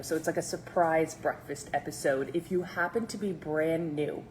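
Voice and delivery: ordinary talk, nothing marked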